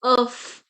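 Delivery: oof with the final F sounded; French final F. Shown as a demonstration. Teacher showing last letter F, oof with sounded F. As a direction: say oof loudly, holding onto the closing F. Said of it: "we are pronouncing the last letter F".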